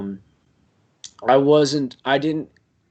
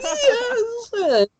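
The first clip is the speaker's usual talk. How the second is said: high squeak. Retold or imitated. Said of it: "my fiance's like".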